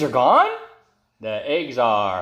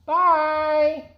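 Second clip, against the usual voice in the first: cheerful bye; 13 semitones higher than usual. Acted. Waving birds off.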